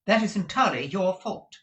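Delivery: sweet but firm wife; lightly voiced fond wife; Madame's voice. A narrator's reading. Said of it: "said Madame".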